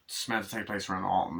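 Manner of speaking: ordinary talk, nothing marked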